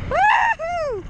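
high joyful whoop; excited woohoo. Real, not acted. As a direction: whoop with thrill. Thrilled at speed.

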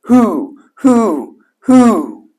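high, falling owl hoots; three high hoots, each swooping down. A narrator's reading. Mother Owl calls up the sun, hooting. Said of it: "turned her head toward the east and hooted".

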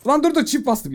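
high, loud panicked "dur dur"; panicked outburst. Real, not acted. Gamer panicking at his double keypress, loud and high. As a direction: cry out in alarm at the mistake. Pressed a key twice by mistake.

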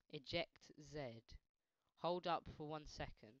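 usual speaking voice

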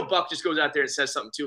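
normal talking voice

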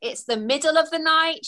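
usual speaking voice

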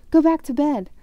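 loud, high angry order; furious. A narrator's reading. Ron ordering Hermione back to bed, furious. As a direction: send them off with real force. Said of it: "said Ron furiously".

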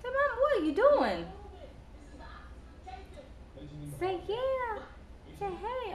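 high, soft coaxing coo. Real, not acted. Mother coaxing her baby to say hey, high soft coo. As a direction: coax him up high and soft.

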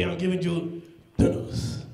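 ordinary talk, nothing marked

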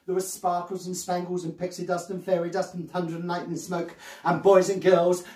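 usual speaking voice

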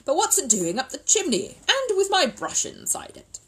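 loud, high, indignant surprise; baffled outburst; astonished questioning. Acted. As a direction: demand an explanation, pitch climbing. Mister Brown's astonished question.

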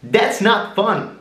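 very high whiny falsetto; high, whiny complaint; squeaky high voice. Shown as a demonstration. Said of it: "start to sound like a hillbilly".

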